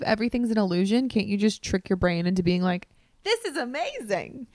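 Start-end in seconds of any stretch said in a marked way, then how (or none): 3.2-4.5 high, put-on wonder; mock delight. Tricking your brain into bliss, mock delighted. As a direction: lift it high as if talking yourself into joy.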